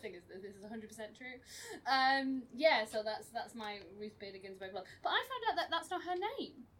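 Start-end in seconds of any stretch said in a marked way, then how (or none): none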